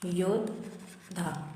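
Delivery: योद्धा stretched very slowly and softly; slow careful word. Shown as a demonstration. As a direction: say योद्धा slowly, letting it fade. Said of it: "योद्धा नहीं होता".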